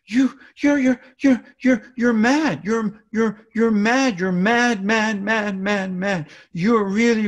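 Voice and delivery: put-on high, loud, overly dramatic baby talk; emphatic repeated bursts echoing a child's anger, easing off at the end; toddlerese mirroring. Shown as a demonstration. Parent to an upset little child, dramatic mirroring. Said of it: "lots of repetition and mirroring a third of their emotion".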